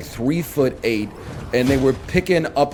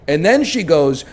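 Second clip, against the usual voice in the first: ordinary talk, nothing marked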